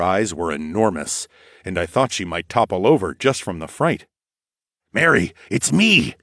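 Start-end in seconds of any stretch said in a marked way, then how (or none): none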